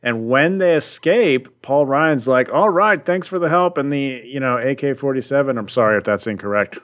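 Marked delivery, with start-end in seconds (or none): 2.5-3.8 lightly voiced gung-ho soldier, higher; mock eager soldier. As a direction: imitate a soldier a bit too keen. Acts out Ryan's cocky quote.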